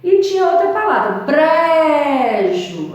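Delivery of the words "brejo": "brejo" with a long, loud, raised BRE; "brejo", first syllable stretched high; emphasis on BRE. Shown as a demonstration. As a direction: belt out and hold the BRE in "brejo". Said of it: "você dá mais aquela ênfase".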